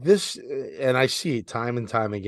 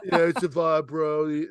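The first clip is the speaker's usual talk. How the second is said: put-on half-hearted Philly accent; 4 semitones higher than usual. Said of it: "I, I can't even do the accent".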